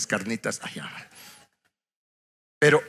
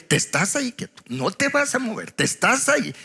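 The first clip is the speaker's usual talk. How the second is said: lightly voiced wife, higher-pitched orders; esposa firme; 9 semitones higher than usual. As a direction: imitate a wife laying down the law, pitched up. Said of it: "una esposa sabia que dice". (esposa que manda, imitada en tono más agudo)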